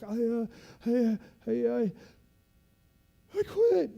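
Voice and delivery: rising stutter; a broken recruit giving up; retold stammering quit. Trainee stammering he quits.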